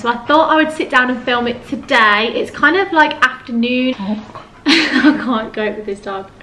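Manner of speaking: ordinary delivery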